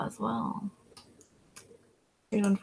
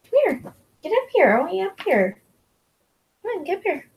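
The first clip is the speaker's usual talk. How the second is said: high, sing-song pet voice, calling him up; high coaxing call to a pet; coaxing the dog; 10 semitones higher, faster than usual. Real, not acted. Coaxing her dog up onto the couch, high sing-song voice.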